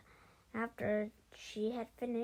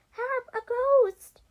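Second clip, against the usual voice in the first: very high, loud terrified shriek; piercingly loud cry of fright; 12 semitones higher, 16 dB louder, slower than usual. A narrator's reading. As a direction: shriek for help at the top of your voice. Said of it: "I shrieked".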